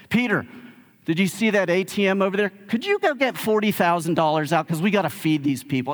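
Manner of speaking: starts high and exasperated, dips to a dry aside, lifts again; mock-agreeable, pretending an absurd errand is easy; hangry cutting sarcasm. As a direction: imitate irritable, tired sarcastic compliance. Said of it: "kinda cutting sarcasm".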